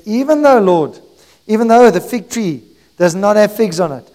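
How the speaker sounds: loud, rising preacher's cry of trust; full-force declaration prayer. Acted. Preacher declares trust to the Lord despite troubles, full-force preaching. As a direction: build each "even though" like a rallying prayer.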